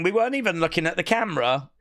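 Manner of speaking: usual speaking voice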